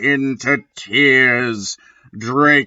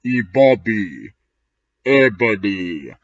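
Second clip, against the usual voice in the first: low summoning call; lightly voiced dark lord; 4 semitones lower than usual. Voldemort calls Ebony in a low ominous voice. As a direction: call her name lower, ominous.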